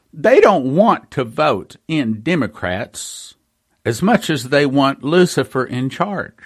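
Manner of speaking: usual speaking voice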